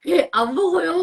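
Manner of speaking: put-on high, loud childlike answer through laughter; laughing kid-voice denial; child's reply imitated. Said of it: "막 이러는데".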